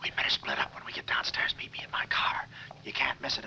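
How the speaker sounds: urgent hushed whisper. Acted. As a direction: whisper the plan so nobody hears. Sneaking out, hushed escape plan.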